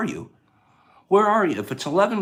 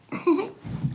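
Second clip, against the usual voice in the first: high laugh; 13 semitones higher, 6 dB quieter than usual. Real, not acted. Laughing at own joke.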